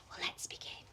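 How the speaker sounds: hushed bedtime whisper. Acted. Storyteller whispering to the children to begin. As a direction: breathe it softly to start the story.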